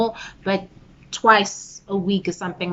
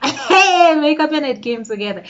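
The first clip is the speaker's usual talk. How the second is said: excited; 8 semitones higher, 10 dB louder than usual. Real, not acted. Laughing, feeling herself.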